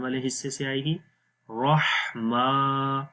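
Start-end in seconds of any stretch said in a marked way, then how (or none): 1.5-3.0 very slow, رحمہ with h from the middle throat; throat ha demo. Shown as a demonstration. Teacher showing the throat sound. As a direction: draw out رحمہ slowly. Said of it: "کھڑی زبر ہے، اس کو بھی لمبا کریں گے".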